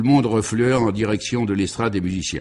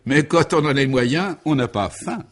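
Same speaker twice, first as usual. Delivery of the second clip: joyful quip; 6 semitones higher than usual. A narrator's reading. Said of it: "intervint joyeusement".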